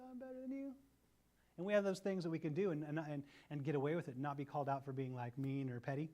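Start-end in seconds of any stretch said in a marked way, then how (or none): 0.1-0.7 a hint of smug sneer, softer and higher; snide superiority. A sibling asserting superiority, lightly smug. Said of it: "a little bit of superiority".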